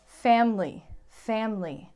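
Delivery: family said as FAM-lee, the middle vowel dropped; two-syllable family. Shown as a demonstration. Teacher showing the American FAM-lee. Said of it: "most Americans will say family".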